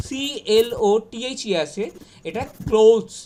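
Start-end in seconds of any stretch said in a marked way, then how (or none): 2.7-3.1 ক্লোথস as one syllable, "klohz"; loud, clear ক্লোথস; model pronunciation. Shown as a demonstration. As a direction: stretch the "o" of ক্লোথস. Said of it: "এরমভাবে আমরা উচ্চারণ করব".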